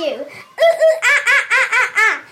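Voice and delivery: loud, high monkey whoops; monkey chatter. A narrator's reading. Monkeys mocking the hat seller, loud chattering. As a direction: chatter and screech like excited monkeys. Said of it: "chattered the monkeys".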